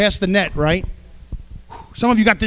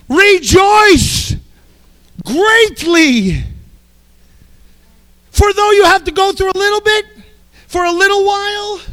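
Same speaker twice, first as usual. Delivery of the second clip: preaching at full force; 6 semitones higher than usual. Acted. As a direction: fire up the congregation with joy.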